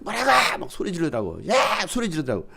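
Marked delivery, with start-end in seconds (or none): 0.0-0.6 mock yell. Son yelling at sister. 1.5-1.9 mock yell. Son yelling.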